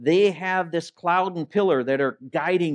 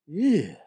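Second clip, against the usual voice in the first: acted "ew" with a rise and fall; disgusted "ew"; 3 semitones higher than usual. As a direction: recoil in mock disgust. Acts out loathing the meat.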